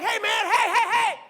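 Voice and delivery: high laughing shout; excited warning. Retold or imitated. Man warning best friend in the bar, high laughing shout. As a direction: call out to your buddy, laughing.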